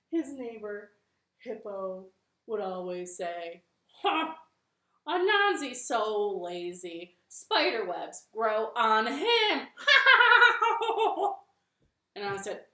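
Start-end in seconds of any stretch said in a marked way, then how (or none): 4.0-9.7 mocking hippo. Hippo mocks Anansi. 9.7-11.4 big loud mocking hippo laugh.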